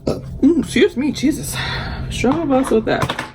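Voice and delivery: choked up and pitched high, fighting back tears; real grief breaking through mid-sentence. Real, not acted. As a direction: let the words crack as tears come. Excuse me, Jesus.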